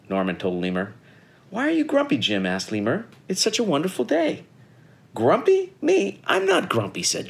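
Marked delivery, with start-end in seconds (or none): 1.5-4.4 high, lilting question to a friend; cheery lemur. A narrator's reading. Lemur asking grumpy Jim about his day, cheery. 5.1-7.0 high-pitched, affronted protest; indignant denial. A narrator's reading. Jim denying to Lemur that he is grumpy, indignant.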